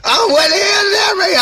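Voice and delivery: stunned outburst at the paper; shocked shout. Retold or imitated. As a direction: shout it out in disbelief, dragging it. Retelling shock at the sheet.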